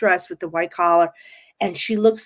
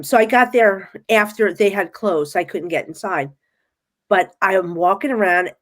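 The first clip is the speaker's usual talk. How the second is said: ordinary talk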